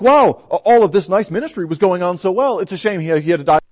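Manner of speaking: high, loud, gushing mock lament; mock naive sigh. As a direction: parody a shallow admirer's regret. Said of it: "make the opposite mistake and think".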